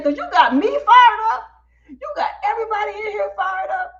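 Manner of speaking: high, loud excited praise; hammed-up, high-pitched hype; fired up. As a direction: imitate a host all worked up. Fired-up host, retold.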